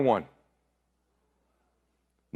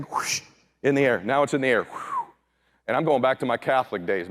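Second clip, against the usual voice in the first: ordinary talk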